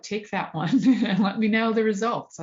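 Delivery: laughing through a playful dare. Real, not acted. To a student looking for a study, laughing.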